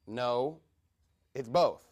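ordinary delivery

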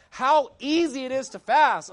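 high, loud mock pulpit rant; imitated screaming. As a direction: mimic a preacher hollering his point. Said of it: "he's, like, screaming and slamming the pulpit".

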